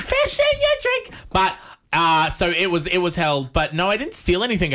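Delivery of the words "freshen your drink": much higher, squeaky put-on servant voice; high mock-posh echo; mock butler echo. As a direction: parrot the butler line in a hammed-up falsetto. Echoing the mock butler line.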